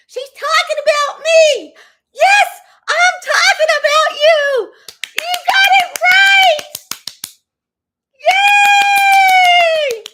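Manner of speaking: high, loud sarcastic whooping; mock celebration aimed at the troll, shrieked high and loud; mock cheering. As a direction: cheer her on like a fake fan. Mocking cheer at her troll.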